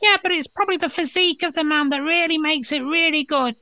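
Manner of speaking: very high squeaky little voice; high-pitched voice complimenting a haircut; tiny voice. Acted. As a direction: squeak it like a peanut. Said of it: "hears another one going".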